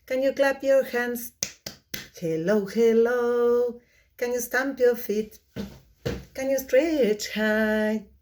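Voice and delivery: put-on fast singsong kids' song; sped-up singsong puppet show host. Shown as a demonstration. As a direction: sing it again, very quickly. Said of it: "very, very quickly".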